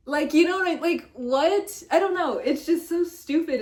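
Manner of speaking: high, loud incredulous rant; squealing disbelief, half laughing; exasperated. Real, not acted. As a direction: throw your hands up at how dumb it is. Ranting about a dumb repost.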